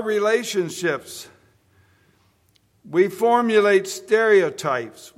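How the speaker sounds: loud, slow, hammering sermon climax; preaching at full force. Acted. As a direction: preach it to the back pews.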